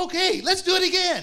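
very high, loud, eager cry; David thrilled to try again; excited. Retold or imitated. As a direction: shout it out with fresh hope. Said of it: "David got all excited".

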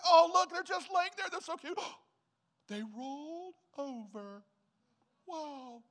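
put-on high cooing baby-talk voice, gushing; cooing parent. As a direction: gush over the baby.

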